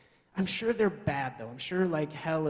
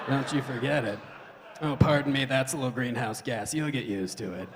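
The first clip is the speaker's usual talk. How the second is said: lower, raspy-free weary single mom pitch on a date; Mother Earth dating; 4 semitones lower than usual. Acted. Mother Earth on the dating scene, weary and lower. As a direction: play a worn-out mom on a date. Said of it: "on the dating scene like".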